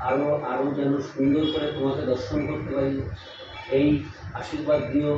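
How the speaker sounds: soft, low murmured prayer to the goddess; hushed devotional plea; quiet prayer. Real, not acted. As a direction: pray softly, eyes closed before the deity. Prays softly before the goddess.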